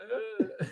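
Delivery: very high, dazed grunt; dazed groan. Retold or imitated. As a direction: groan like you've been knocked out. Dazed Marv after brick.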